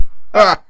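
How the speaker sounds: high, loud, sadistic laugh; cruel laugh. A narrator's reading. Unseen tormentor laughing through the night, sadistic cackle. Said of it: "a cruel laugh slowly and sadistically".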